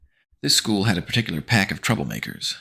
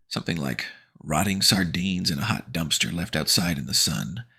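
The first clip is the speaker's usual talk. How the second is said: plain reading voice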